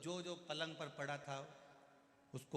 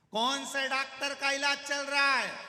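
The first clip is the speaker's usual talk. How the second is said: shouted question; 11 semitones higher, 13 dB louder than usual. Retold or imitated. Deaf visitor shouts a question at the patient.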